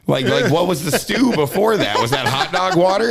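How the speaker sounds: high, loud, laughing questions; laughing disbelief. Retold or imitated. Grandson questioning grandma's stew, laughing high disbelief. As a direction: question it incredulously through laughter. Mock interrogation of grandma's cooking.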